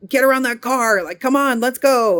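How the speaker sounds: mock road impatience, hurry the driver; half-hearted yell at the car ahead; impatient urging. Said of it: "I was like".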